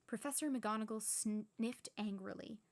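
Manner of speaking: ordinary delivery, nothing marked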